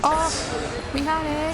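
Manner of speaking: squeaky high anime voice; anime character voice. Pretend. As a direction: pitch way up like a cartoon girl. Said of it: "I sound like an anime character".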